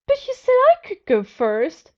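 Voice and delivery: high, loud whining complaint; whiny Jack. A narrator's reading. Jack protests the boss's order, high loud whine. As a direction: whine it like a spoiled kid. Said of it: "Jack whined".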